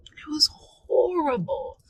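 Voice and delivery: a whispered, stunned recollection; horrified whisper. Real, not acted. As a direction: hush it, barely voiced. Horrified hush.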